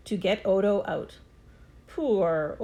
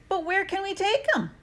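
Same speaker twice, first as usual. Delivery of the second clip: put-on boy asking; 10 semitones higher than usual. A narrator's reading. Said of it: "I asked".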